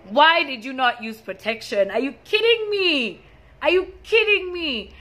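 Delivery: loud, high, disbelieving demand; angry confrontation. Retold or imitated. Confronting her boyfriend over protection, a loud high disbelieving demand. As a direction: shout it at him in disbelief. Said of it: "I just kept on asking".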